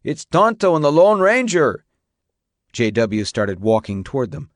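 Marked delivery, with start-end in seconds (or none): 0.0-1.8 loud, high-pitched jeer; yell. A narrator's reading. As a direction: call it out across the distance. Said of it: "one of them yelled".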